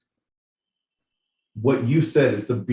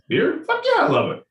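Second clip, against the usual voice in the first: very high, squeaky mock voice; squeaky drunk yes-man. Mocking beer-loving crowds, a very high squeaky mock cheer. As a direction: squeal it like a giddy cartoon drunk.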